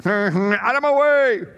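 mock road rage at the cars ahead, slow and high; a playful shout to clear the road; mock yell. Joke driver yelling at traffic.

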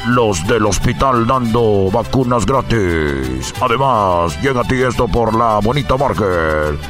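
low, fast sponsor rundown; sponsor list read. Acted. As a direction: rattle off the sponsors deep and quick.

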